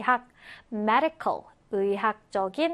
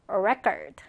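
"record" with the first syllable stressed, REC-ord; 4 semitones lower than usual. Shown as a demonstration. Teacher models the noun for students, stress on first syllable of "record". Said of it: "강세가 앞음절에 있죠".

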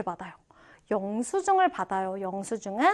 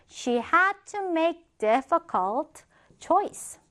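slow, higher, word-by-word reading; slow model reading; 5 semitones higher, much slower than usual. Shown as a demonstration. Teacher first models the example sentence for learners, slow model reading. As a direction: read it out slowly, drawing out each word.

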